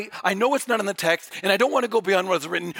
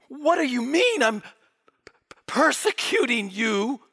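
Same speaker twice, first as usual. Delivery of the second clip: higher, bewildered, sticking on the p; stammering disbelief; 4 semitones higher than usual. Acted. Paul blinded on the ground, stammering in disbelief. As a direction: stumble on "persecuting" in baffled protest. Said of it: "imagine the thoughts in his head".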